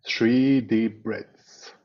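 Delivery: ordinary talk, nothing marked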